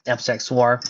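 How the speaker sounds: usual speaking voice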